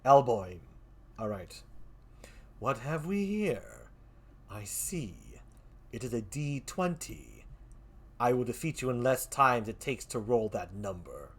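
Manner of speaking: low, slow villain voice, quiet and deliberate; menacing game face. Acted. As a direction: play the cold arch-rival taunting his foe.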